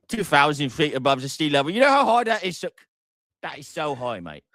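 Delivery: high-pitched, hammed-up Cockney impression; Cockney accent. Shown as a demonstration. Host showing Stifler his British accent, hammed-up Cockney. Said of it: "I could do an Cockney one".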